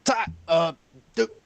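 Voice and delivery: high, stammering shopkeeper's plea; rattled shopkeeper. Acted. Threatened shopkeeper begging Duke for help, rattled.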